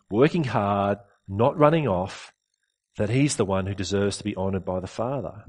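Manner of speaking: usual speaking voice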